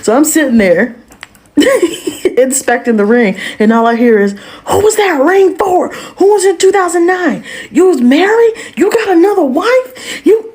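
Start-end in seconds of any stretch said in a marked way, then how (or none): 4.7-10.5 hammed-up jealous wife screaming accusations; retold wife yelling. Wife yelling at husband offstage.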